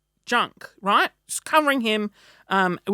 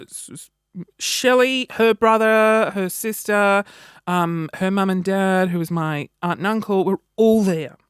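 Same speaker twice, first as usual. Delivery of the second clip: normal talking voice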